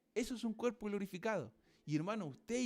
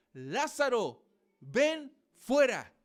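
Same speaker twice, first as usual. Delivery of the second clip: slow, loud and higher call; loud cry; 4 semitones higher, 7 dB louder, much slower than usual. Retold or imitated. Preacher voicing Jesus calling Lazarus, slow loud cry. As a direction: call the dead man out of the tomb. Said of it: "exclamó en alta voz".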